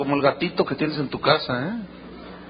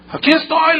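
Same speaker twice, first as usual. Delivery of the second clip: raised, higher-pitched answering call; calling back; 8 semitones higher, 4 dB louder than usual. Acted. Daniel answering the king from the pit, calling back.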